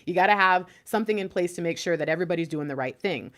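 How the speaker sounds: ordinary talk, nothing marked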